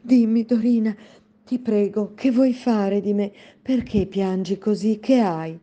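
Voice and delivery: pleading. Acted.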